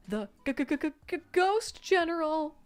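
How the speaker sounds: high, slow stammered shriek; terrified stutter. Acted. Juniors shrieking in terror at the ghost general. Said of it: "screamed and shrieked again".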